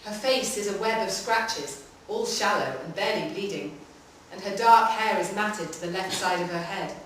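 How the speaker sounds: ordinary delivery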